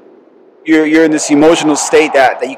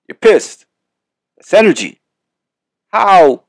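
higher, louder burst of anger; mock anger; 7 semitones higher, 5 dB louder, slower than usual. Picturing a car crash for listeners, a higher louder angry burst. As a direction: push it up like you're pissed.

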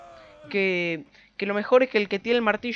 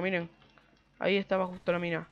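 normal talking voice

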